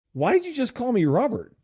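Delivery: retelling his own startled reply to the AI; baffled question. Retold or imitated. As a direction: ask it like you can't believe what you heard. Puzzled quote of himself to AI.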